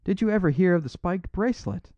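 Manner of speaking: high, swooping question; astonished cry. A narrator's reading. Robber questioning the priest, crying out astonished. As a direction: demand it in high-pitched astonishment. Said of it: "cried Flambeau".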